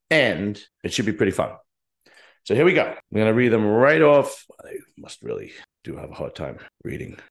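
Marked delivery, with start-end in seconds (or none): 4.6-7.2 soft self-mocking whisper under the breath; a breathy aside, half to himself. Real, not acted. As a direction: mutter it to yourself, ruefully. Self-mocking aside while reading.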